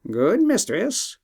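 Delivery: a lightly voiced wise bird; talking bird.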